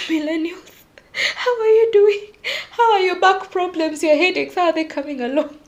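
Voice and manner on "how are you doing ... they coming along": high, loud check-in on fellow sufferers; teasing mock concern. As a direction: tease them with exaggerated concern, pitched up. Teasing address to fellow millennials.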